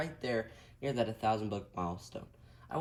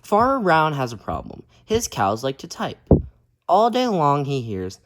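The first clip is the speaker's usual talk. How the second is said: lively, loud storybook reading; reading to children; 13 dB louder, slower than usual. A narrator's reading. Teacher reading a picture book aloud to kids, lively. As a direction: read it out big for the kids.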